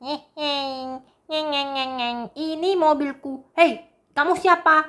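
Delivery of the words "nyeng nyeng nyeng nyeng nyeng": soft imitated car engine; engine noise. Cat drives his toy car around town, making soft engine noises. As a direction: hum the motor as the toy drives.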